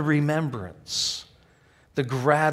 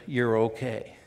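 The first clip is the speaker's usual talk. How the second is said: ordinary talk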